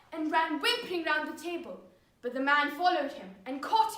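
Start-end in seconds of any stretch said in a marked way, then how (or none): none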